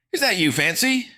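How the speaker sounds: high, loud shouted question; yelling. A narrator's reading. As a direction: yell it through the door. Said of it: "Jackson yelled".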